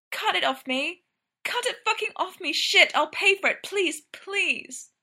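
high-pitched, urgent begging; desperate pleading. A narrator's reading. Brendan begging Ryan to cut the corset off, pleading desperately. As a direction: plead to be freed, pitch shooting up.

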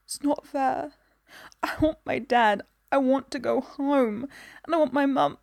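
tearful plea. A narrator's reading. Child crying for her parents.

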